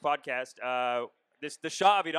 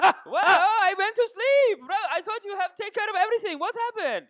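put-on high, loud laughing Persian accent; 13 semitones higher, 5 dB louder than usual. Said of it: "I do Persian one all day".

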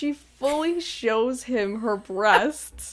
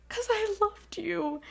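retold tearful confession; 9 semitones higher, 4 dB quieter than usual. She's crying.

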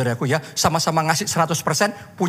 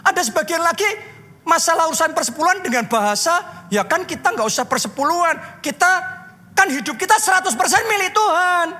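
high, insistent sermon pitch; worked-up preacher, high-pitched and emphatic; impassioned preaching; 11 semitones higher than usual. Real, not acted. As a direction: press the challenge on the congregation, building to a shout. Preaches at full force.

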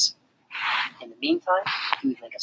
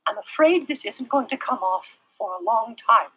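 sorry teacher; 9 semitones higher than usual. A narrator's reading. Teacher voiced.

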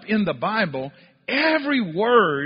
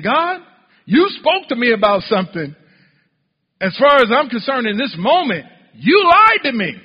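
loud, raised-pitch accusing complaint; frustrated with God; 6 semitones higher, 10 dB louder than usual. Acted. Preacher voices the prophet confronting God, frustrated. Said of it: "he's frustrated with God".

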